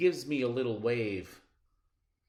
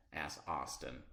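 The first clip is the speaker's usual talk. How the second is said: plain reading voice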